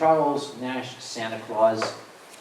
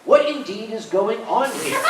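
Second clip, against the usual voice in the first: prim, overly polite paraphrase, higher and louder, laughing; mock posh translation; 9 semitones higher, 5 dB louder than usual. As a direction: translate it like a prissy butler. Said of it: "Which in New York means".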